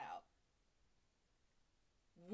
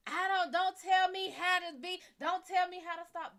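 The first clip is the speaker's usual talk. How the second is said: angry; 9 semitones higher, 10 dB louder than usual. Acted. Acted quarrel, wife snaps back.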